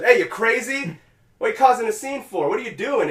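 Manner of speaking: high-pitched mock indignant cop; scolding cop. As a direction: act out an officer challenging a troublemaker. Cop confronting him, acted out.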